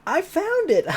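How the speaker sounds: high, loud triumphant cry; excited shout. Acted. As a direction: shout it out like you just struck gold. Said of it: "popped out at the very moment shouting".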